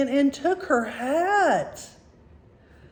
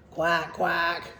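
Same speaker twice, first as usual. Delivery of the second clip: lower-pitched double duck quack; 5 semitones lower than usual. A narrator's reading. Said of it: "Look at the ducks. It says".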